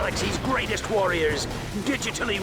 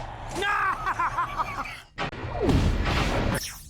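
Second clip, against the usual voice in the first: villainous laugh; 9 semitones higher than usual. Acted. Villain announcing the finale.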